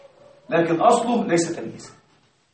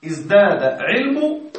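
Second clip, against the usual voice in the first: slow example read; much slower than usual. Shown as a demonstration. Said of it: "تشعر أن الجملة في الأصل".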